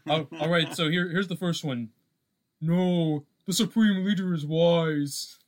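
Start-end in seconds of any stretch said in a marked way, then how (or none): 2.6-5.4 slow, solemn villain line, a bit louder; serious movie line. Retold or imitated. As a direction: deliver it like a grave dark-side commander. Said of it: "I have a serious line".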